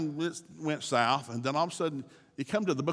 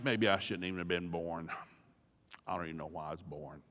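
much lower and softer, hopeless; despairing Job; 10 semitones lower, 8 dB quieter than usual. Acted. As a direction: let it sink into despair.

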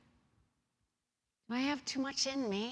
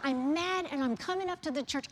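normal talking voice

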